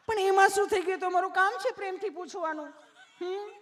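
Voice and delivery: very high, loud put-on nurse; sweetly asking nurse. Nurse explaining her job, high mock sweetness. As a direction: gush it high at the patient. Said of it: "તમે કેટલું પ્રેમથી પૂછો છો".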